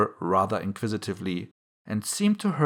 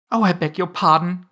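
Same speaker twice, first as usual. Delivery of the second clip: higher, louder flustered apology; hasty cry; 7 semitones higher, 6 dB louder than usual. A narrator's reading. Alice has frightened the mouse, hasty apologetic cry. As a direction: blurt the apology up high. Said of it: "cried Alice hastily".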